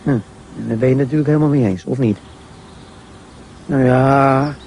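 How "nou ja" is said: a slightly hesitant nou ja; a bit hesitant. A narrator's reading. A son caught out by his father's question, slightly hesitant. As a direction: pause over it, unsure. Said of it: "zegt Job een beetje aarzelend".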